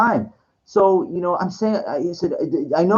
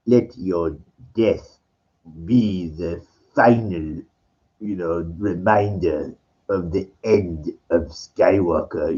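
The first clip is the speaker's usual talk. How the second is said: much lower, slow, menacing Emperor drawl; low slow villain voice; 13 semitones lower, 4 dB quieter, slower than usual. Retold or imitated. Telling a friend about the film, doing Palpatine's low slow menace. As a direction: growl it slowly like a dark emperor. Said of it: "he goes like".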